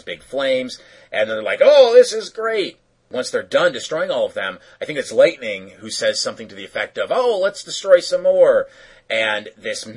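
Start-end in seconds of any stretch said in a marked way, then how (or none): 1.6-2.8 high, loud, drawn-out delight; hammed-up thrill at the mayhem; mock glee. Voices Thunder and Lightning enjoying destruction. 7.1-8.7 higher, louder mock enthusiasm; imitated eagerness. Voices Lightning eager for more destruction.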